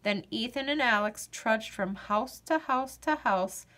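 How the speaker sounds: usual speaking voice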